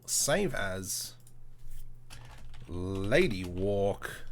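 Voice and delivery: ordinary delivery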